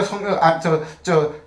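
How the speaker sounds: normal talking voice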